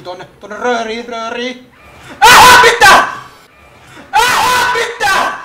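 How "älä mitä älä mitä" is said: loud, high laughing yell; laughing shout. Real, not acted. Laughing disbelief. (naurava huuto)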